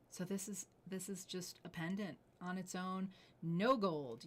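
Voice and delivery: normal talking voice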